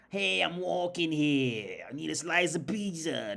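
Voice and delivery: exaggerated New York accent, high and drawn out; cartoonish New York street accent. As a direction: badly fake a New Yorker, loud and slow. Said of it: "I can't do it".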